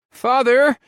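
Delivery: high, loud desperate plea; begging. A narrator's reading. Son begging his father to stop. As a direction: cry out to him. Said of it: "I begged".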